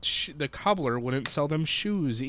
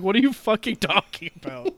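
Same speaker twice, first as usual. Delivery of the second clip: baffled, high-pitched outburst; mock bewildered coworker; incredulous; 8 semitones higher, 4 dB louder than usual. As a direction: demand an explanation in raised-voice disbelief. Coworker's baffled reply, acted out.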